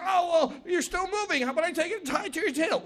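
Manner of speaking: high, light, chattering voice; small trickster talking fast to persuade; scheming little Anansi. Acted. Anansi talks python into being tied, high chatter.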